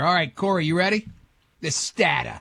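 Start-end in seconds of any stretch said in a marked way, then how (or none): 1.6-2.4 lightly mocked New England dropped r's; mild mock New England "stata"; New England accent. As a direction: toss out a New England imitation. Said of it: "It's one annoying accent after the other".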